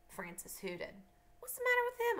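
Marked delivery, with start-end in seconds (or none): 1.4-2.2 put-on high, loud child's retort; indignant challenge. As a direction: jump to his defence, pitched way up. Girl snaps back at hooting cousin.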